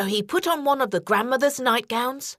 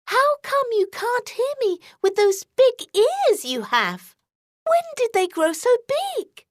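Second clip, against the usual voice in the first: put-on higher, questioning girlish voice; girl eyeing the bed, higher; suspicious girl; 11 semitones higher than usual. A narrator's reading. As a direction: ask it doubtfully like a wary child. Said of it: "looking at the bed suspiciously".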